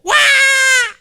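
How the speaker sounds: put-on piercing high shriek of a possessed girl; sudden scream. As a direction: let out a wild startled scream. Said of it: "biglang sumigaw". (biglang sigaw na matinis at malakas)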